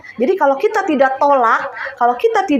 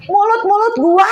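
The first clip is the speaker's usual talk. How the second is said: high, louder retold snap; mock angry retort; 8 semitones higher, 4 dB louder than usual. Wife imitates her angry husband talking back, mock angry retort. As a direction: imitate an angry man, voice pitched up. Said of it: "suami saya marah pernah lu".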